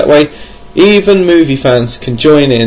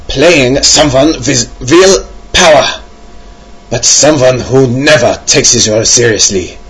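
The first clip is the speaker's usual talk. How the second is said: hammed-up German accent. Host quoting a listener's reasoning, mock German accent. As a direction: read the quote as a comic German. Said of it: "I could do a German accent".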